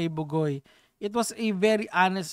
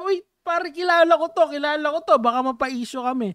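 raised, urgent heads-up to a buddy; acted-out warning to a pal; alarmed recognition; 5 semitones higher, 5 dB louder than usual. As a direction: blurt it out high and loud, then ease down. Acting out what host should say.

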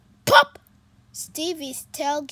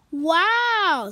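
louder, delighted gasp of a word; amazed wow; 5 semitones higher, 8 dB louder than usual. Acted. Stevie seeing red appear, amazed. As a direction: marvel at it.

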